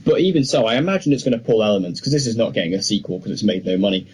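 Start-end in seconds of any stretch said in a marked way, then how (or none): none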